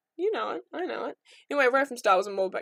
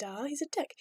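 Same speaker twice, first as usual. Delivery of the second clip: ordinary delivery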